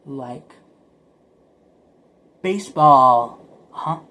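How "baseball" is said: slow loud drawn word. Real, not acted. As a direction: hammer the word home. Emphatic punchline word.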